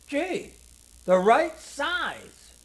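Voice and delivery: high, slow amazed sailor voice; lightly voiced sailor; Joe's gruff wonder. A narrator's reading. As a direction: marvel at the gloves. Joe tries on gloves, character voice.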